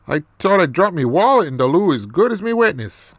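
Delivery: quick, lilting mock Irish brogue; Irish accent. Man swearing about his dropped wallet, Irish accent. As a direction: rattle it off in a put-on Irish accent. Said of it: "more of this Irish accent".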